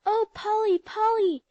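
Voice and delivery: high loud eager calling; excited cry. A narrator's reading. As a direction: girls greeting a friend with glee. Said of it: "they cried".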